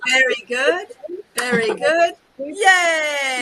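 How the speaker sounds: bright high cheer. Shown as a demonstration. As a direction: cheer like you've won. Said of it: "and then we say".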